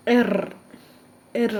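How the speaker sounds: "എർ" with a strongly rolled R; strong German R. Shown as a demonstration. Teacher showing learners the strong German R, "എർ" with R rolled. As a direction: roll out the R at the end of "എർ". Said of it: "അങ്ങനെ തന്നെ pronounce ചെയ്യണം".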